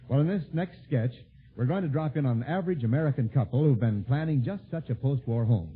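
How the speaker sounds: normal talking voice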